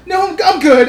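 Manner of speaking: retold mom brushing off her daughter, loud and high. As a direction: wave off the seat, loud and up high. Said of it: "And then she's like".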